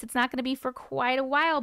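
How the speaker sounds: ordinary talk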